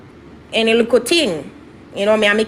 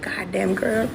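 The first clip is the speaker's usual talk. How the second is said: soft, whispered quoted exclamation; imitated hushed reaction; 3 semitones lower, 6 dB quieter, slower than usual. Office lady reacts to hearing of the slap, hushed imitation. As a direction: react under your breath, as the listener did.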